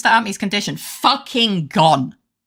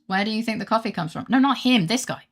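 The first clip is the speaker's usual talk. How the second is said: ordinary talk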